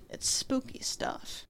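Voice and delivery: whispered, slow, ghost-story hush; spooky whisper. Acted. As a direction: breathe it like a campfire secret.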